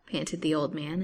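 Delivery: plain reading voice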